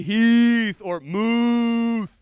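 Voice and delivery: booming drawn-out name calls; stretched, shouted hype names; loud announcer calls. Acted. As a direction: call their names like a ring announcer.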